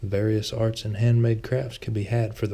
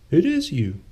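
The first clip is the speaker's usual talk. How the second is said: surprised; 8 semitones higher, 5 dB louder than usual. A narrator's reading. Old friend recognises Lucian.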